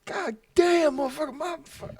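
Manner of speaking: very high, strained mock wail; imitated pained cursing. Friend who got stepped on, imitated high pained cursing. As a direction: curse in a squealing put-on agony.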